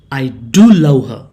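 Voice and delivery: "do" punched loud, "love her" falling after; emphatic DO. Shown as a demonstration. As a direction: hit "do" hard to insist on it. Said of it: "you stress that love".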